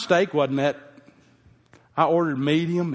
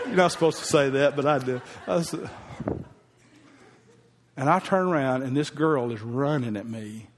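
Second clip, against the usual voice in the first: normal talking voice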